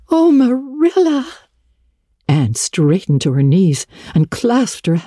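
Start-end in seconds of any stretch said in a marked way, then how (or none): 0.1-1.3 put-on a girl whose dream comes true; thrilled gasp. A narrator's reading. Anne crying out to Marilla in delight. Anne's joyful outburst.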